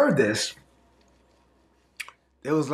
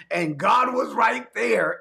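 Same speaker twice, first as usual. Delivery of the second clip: high, slow shouted declaration; preaching shout; 7 semitones higher, slower than usual. Real, not acted. Pastor to congregation, shouted slow high emphasis. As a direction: hammer home that God was right there.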